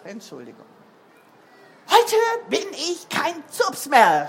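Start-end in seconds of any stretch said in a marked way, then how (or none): 1.9-4.3 put-on loud, high, jubilant child; beaming. A narrator's reading. Recovered Bruno hops into Mama's kitchen, beaming loudly. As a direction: burst out with the good news. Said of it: "strahlte Bruno".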